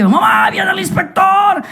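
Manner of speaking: high, drawn-out shout; acted high-pitched alarm; alarmed warning cry. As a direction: call out in panic to warn mother. Child warns of inspector coming.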